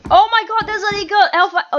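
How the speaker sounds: high, loud real exclamation; excited outburst. Real, not acted. Gamer spotting an Eagle Alpha, excited outburst. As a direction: burst out amazed, breaking into a laugh. Surprised by weapon in live game.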